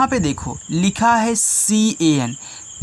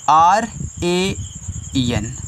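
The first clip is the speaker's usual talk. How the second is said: slow spelling; much slower than usual. Shown as a demonstration. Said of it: "spelling letters for pupils".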